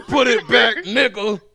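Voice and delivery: high, loud playful yell; mock yell. Host teases the Canadian friend about maple syrup, loud and high. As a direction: yell at him as a joke.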